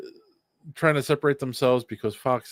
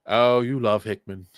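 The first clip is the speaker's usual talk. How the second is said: ordinary talk, nothing marked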